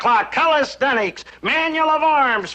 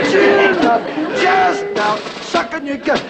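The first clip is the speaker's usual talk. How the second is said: barking out exercise counts like a drill sergeant; drill sergeant calls; 4 semitones higher, much slower than usual. Acted. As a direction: drill them hard through the reps. Father drilling boys in exercises.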